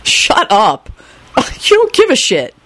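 impatient, rising in pitch; unsympathetic snap. A narrator's reading. Henry silences Kenny, snapping. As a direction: snap at him without pity. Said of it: "Henry snapped unsympathetically".

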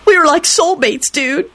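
high, tearful whining; wail. A narrator's reading. Kenny mourns his soulmate, wailing. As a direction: wail it high, like a grieving dude. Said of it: "Kenny wailed".

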